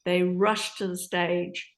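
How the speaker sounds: normal talking voice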